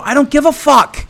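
high, exasperated reenacted outburst; angry retort. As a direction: cut off his excuse with a high, angry snap. Retold furious retort to neighbour.

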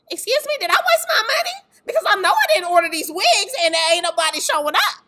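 loud, high-pitched mock outrage; indignant alarm. Organizer asking God about money wasted on wigs, indignant. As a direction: protest as if you have been cheated.

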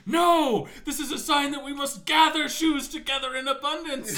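high, loud, laughing fanatic; rival zealot. Retold or imitated. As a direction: shout down the other follower. Rival follower contradicts.

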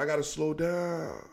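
slowed down. Real, not acted. Excited host calming himself before the story, slowed and hushed. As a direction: settle yourself, dropping to a hush. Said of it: "All right, I'm slowed".

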